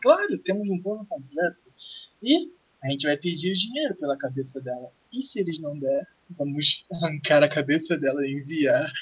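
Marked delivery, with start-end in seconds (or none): none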